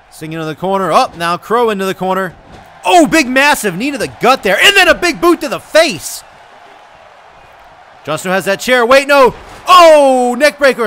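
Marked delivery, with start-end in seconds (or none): 2.9-6.2 loud, rising exclamation on each hit; excited wrestling call. Real, not acted. Big hits called live. 8.9-10.9 loud, high burst on the impact; startled excited call. Real, not acted. Sudden move called live.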